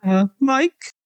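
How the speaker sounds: tiny high-pitched squeak. A narrator's reading. Nervous fan telling the wrestler his name, squeaked. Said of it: "I squeaked".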